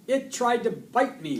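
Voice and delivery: high, loud outcry; alarmed exclamation. A narrator's reading. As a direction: exclaim in shocked complaint. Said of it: "Marty exclaimed".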